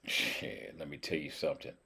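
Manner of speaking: soft, low-pitched confiding line; low, quiet aside. Real, not acted. Cook confiding in viewers about the duck, low aside. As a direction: drop low and keep it quiet. Says it low, then squeaky.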